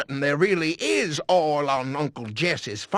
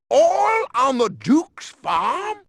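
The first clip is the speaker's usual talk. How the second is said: high, loud, slow shout of disbelief; stunned yell; shocked outburst; 10 semitones higher, 5 dB louder, slower than usual. Acted. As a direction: blurt it out in astonishment. Boss hears oil news.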